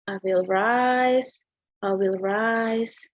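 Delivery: drawn-out, deliberate I will rise; slow emphatic recital. Shown as a demonstration. Student demonstrates the refrain, slow and emphatic. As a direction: savour each rise. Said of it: "Like this".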